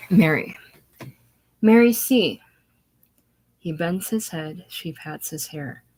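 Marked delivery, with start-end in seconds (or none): 1.6-2.4 put-on higher, loud childlike call; little girl voice. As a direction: sound like a small girl showing off. Child offers comfort.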